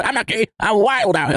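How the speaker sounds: high mock voice for a closeted guy; silly put-on voice. Mocking a closeted homophobe to her cohost in a high silly voice. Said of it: "I keep on doing that voice".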